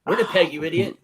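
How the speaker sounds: laughing mock insult. Jokey heckle through laughter.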